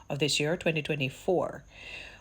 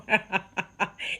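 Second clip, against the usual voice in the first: laugh; 3 semitones higher than usual. Real, not acted. Laughing at the quote.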